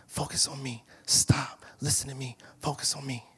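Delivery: hushed urgent whispered directions; whispering voice of God. Retold or imitated. God guiding the believer, quiet whispered urging. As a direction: lean in and whisper each command. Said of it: "and he's like".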